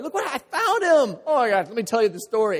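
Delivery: high, loud, fast thrilled announcement; excited. Pretend. Shepherd shows off the found sheep to neighbors, thrilled and loud. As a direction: burst out with the news.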